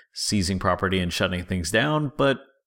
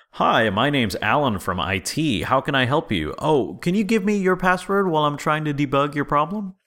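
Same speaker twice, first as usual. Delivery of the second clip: convincing voice; 7 semitones higher than usual. Retold or imitated. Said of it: "a very convincing voice".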